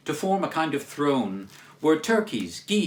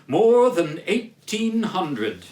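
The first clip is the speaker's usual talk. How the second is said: slow, louder booming answer; grand ghost; 3 semitones higher, 5 dB louder, slower than usual. Acted. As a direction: stretch it out proudly.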